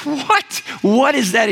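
high, startled "what?"; acted bewilderment. Scared soldier hears an odd offer in battle, bewildered. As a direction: pitch jumps up in mock disbelief.

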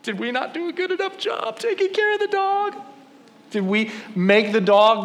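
very high, hammed-up childish wail; mock hysterical kids. Kids losing the dog they cared for, mock wailing high. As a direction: imitate kids wailing in distress. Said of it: "my kids lose it".

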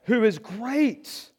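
ordinary talk, nothing marked

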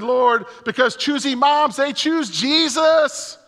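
high, loud hammed-up praying; high, loud comic prayer; mock fervent prayer. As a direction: pray like he's trying to outdo her. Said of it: "And I said".

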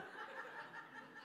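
a short unvoiced laugh; real breathy laugh. Real, not acted. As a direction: laugh at your own joke. Laughs at own joke.

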